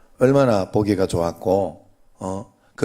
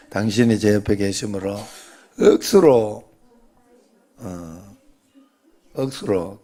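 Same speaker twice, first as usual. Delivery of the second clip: Gyeongsang dialect. Shown as a demonstration. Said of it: "경상도 말로".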